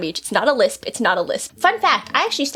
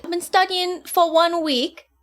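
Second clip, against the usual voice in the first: higher, careful put-on British; attempted British accent; 5 semitones higher than usual. Shown as a demonstration. Student reporting progress, higher attempted British accent. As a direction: pitch it up into British. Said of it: "in a British accent all week".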